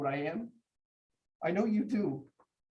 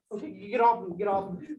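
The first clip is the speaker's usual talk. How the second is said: normal talking voice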